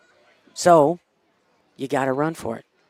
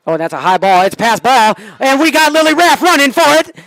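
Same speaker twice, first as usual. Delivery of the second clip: building to a high, loud scream; frantic play call; 11 semitones higher, 11 dB louder than usual. Real, not acted. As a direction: escalate line by line into a shout. Calls passed ball live.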